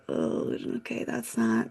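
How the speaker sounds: breathy near-whisper, deflated; stunned under breath. Retold or imitated. Reading her first tiny paycheck, a stunned near-whisper. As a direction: mutter it to yourself, crushed. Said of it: "I'm like".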